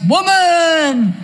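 high, loud grumpy yell of woman; husband's sleepy bark. Retold or imitated. Husband woken by a shove, grumpy yell. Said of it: "It's always".

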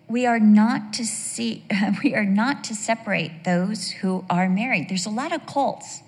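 ordinary talk, nothing marked

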